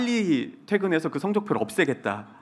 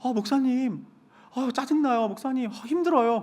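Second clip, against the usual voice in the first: higher, whining complaint; whiny student; 8 semitones higher than usual. Retold or imitated. Student whining to the pastor about being tired. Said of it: "요즘에는요".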